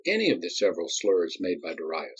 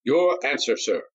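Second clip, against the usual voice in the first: higher, formal intoning; senator intoning; 6 semitones higher, 3 dB louder than usual. A narrator's reading. The senator demanding the captain's answer, formal. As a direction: preside like a congressional debate. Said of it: "the senator repeated".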